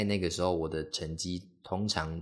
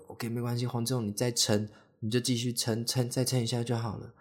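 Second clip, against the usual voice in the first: pitched-up mock pep talk to himself; urging himself to hang on, a bit higher; self pep talk; 5 semitones higher than usual. 给自己打气硬撑. (给自己打气，语调稍高)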